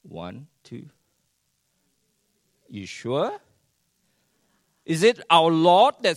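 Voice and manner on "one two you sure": ordinary talk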